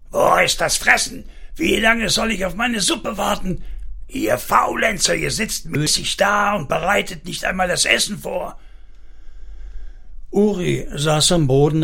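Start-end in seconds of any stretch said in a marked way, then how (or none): 0.1-8.6 higher-pitched angry demand for food; rough angry shouting. A narrator's reading. Father demanding supper from his idle family, rough shouting. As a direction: bellow it in a high, impatient voice. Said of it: "von rauen Schreien".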